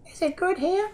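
high, small squeaky toy; toy koala voice. Pretend. Koala toy asking about Australia, high put-on voice. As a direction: ask it as a tiny newcomer.

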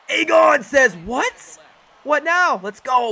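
high, loud hype-man cheer; real jubilant yelling; excited shout. Real, not acted. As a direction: shout it in celebration of your player. Cheering his batter's hit.

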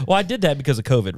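usual speaking voice

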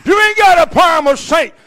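loud, high, driving preacher's call; full-force preaching. Acted. Preacher urging husbands, at full force. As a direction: preach it hard to the congregation.

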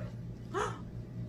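soft, high intake of breath; startled gasp. Acted. Mouse seeing a creature, hushed gasp. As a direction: gasp softly in shock.

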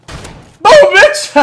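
very high, loud victory yell; gloating shout. Real, not acted. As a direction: yell it as you blast him. Surprise kill on enemy.

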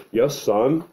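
mock-obliging dad voice; good-dad act. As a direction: play the model dad answering his child. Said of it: "I'll act like a good dad".

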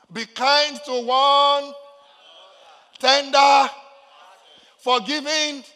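high, loud, stretched phrase ends; shouted scripture. Real, not acted. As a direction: belt out each phrase for the congregation. Calls out verse to congregation.

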